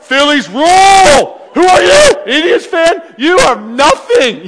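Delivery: shouted high and slow, mock bravado; cocky taunting shout. Fan taunting rival Indians fans, loud mock shout. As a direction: yell it like you own the place.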